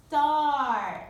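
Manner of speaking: loud clear model of start; model word. Shown as a demonstration. Teacher to class, modeling the word loudly. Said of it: "Repeat after me".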